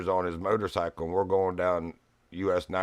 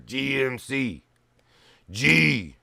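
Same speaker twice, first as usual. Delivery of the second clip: loud, higher gruff shout at the operator; gruff big guy; 7 semitones higher, 11 dB louder, slower than usual. Retold or imitated. Bricklayer yelling at the phone operator, loud gruff voice. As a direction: bellow it like a rude biker. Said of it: "he talks like this".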